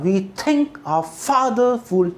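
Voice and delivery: slow beats, stretching "think" and "father"; very slow metrical recital, leaning on "think" and "father"; poet reciting in rhythm. Shown as a demonstration. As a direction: recite like a poet, light "We" and "our", heavy "think". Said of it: "तो ऐसे ही बोलेगा".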